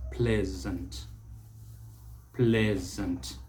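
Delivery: pleasant as PLEZ-unt; short e and buzzing z in pleasant; correct pronunciation. Shown as a demonstration. Teacher models the word slowly, careful pronunciation. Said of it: "the S takes the Z sound".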